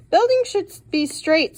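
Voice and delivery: put-on a critical grandpa correcting the boy. Grandpa voiced in read-aloud story.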